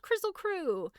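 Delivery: high-pitched call. A narrator's reading. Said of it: "Scraps called out".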